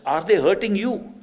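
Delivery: high, loud concerned question; acted urgent check on her. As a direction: call out to someone far away.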